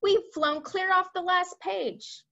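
high, drawn-out storybook lament; slow it down in exasperated alarm; exasperated cry. A narrator's reading. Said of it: "cried the head of the Air Force".

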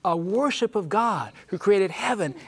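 high, emphatic pulpit summation; fervent preaching climax. Real, not acted. Preacher sums up the everlasting gospel for his panel, fervent preaching. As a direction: drive each point home like a preacher.

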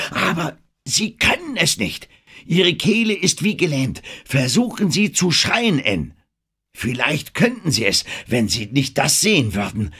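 hammed-up director's commands, high and loud; urgent film director. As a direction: talk her up into fright, pressing harder each line. Denham directs Anne's scream.

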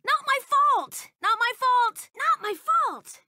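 high loud protest; protesting yell. Acted. Game character denying blame with a high loud shout.

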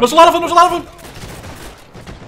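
high, loud alarmed shouting; panicked yell. Real, not acted. Player warning squad of many enemies, panicked high yell. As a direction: sound the alarm, they're swarming you.

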